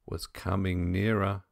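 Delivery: ordinary delivery, nothing marked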